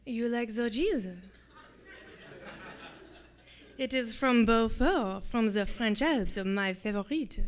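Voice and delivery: put-on slow French-accented English; thick French accent. A narrator's reading. Soldier watching Louisa taste his cheese, slow French accent. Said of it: "With an accent so thick it was nearly impossible to make out his words".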